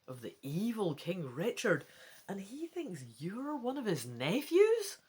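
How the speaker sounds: usual speaking voice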